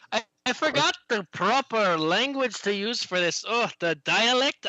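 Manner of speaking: high, drawn-out fretting; flustered halfling. Acted. Halfling player fretting in character.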